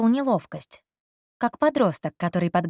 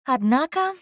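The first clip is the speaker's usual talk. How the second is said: cheerful remark; 8 semitones higher than usual. Acted. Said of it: "весёлое замечание".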